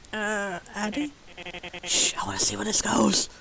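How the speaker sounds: hushed aside to a friend; whispering aside. Real, not acted. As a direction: whisper it under the scene. Aside whisper during scene.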